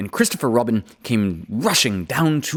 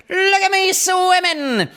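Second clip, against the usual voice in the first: very high, loud squeaky little joey with Australian twang; squeaky Roo; 4 dB louder, slower than usual. A narrator's reading. Roo squeaks proudly to everyone from the pool, very high. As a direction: squeak it out like an excited little kangaroo.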